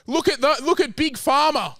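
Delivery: high-pitched, indignant protest; indignant rant. Acted. Pushing back on his co-host with a high indignant protest. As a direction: point the finger at the government. Heated argument about Big Pharma.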